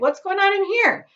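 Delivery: high, loud startled question. Acted. Mr Bucket walks in on the commotion, high and loud. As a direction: demand what the noise is.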